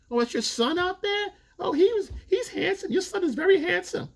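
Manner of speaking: falsetto fake-sweet mockery; sugary high voice hiding the knife; catty club woman. Club girls taunting the older woman about her son, falsetto fake-sweet.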